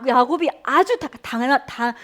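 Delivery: ordinary talk, nothing marked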